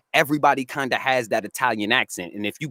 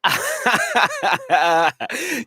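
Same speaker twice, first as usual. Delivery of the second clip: high-pitched burst of real laughter; big laugh; 4 dB louder than usual. Real, not acted. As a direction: crack up in a long, high cackling run. Laughs at being mistaken for another.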